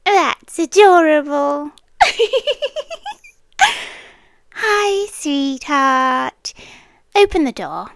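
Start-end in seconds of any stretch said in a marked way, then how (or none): 2.0-3.1 high, amused giggle; mocking laugh. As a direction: giggle at how naive he is. Mocking his sense of safety.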